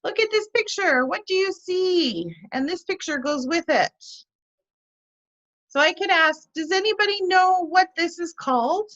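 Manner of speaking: bright, high questioning voice for students; classroom teacher demo. Shown as a demonstration. Teacher showing a picture to students, high and engaging. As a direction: lift the pitch to pull answers out of the class. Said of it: "So here we go".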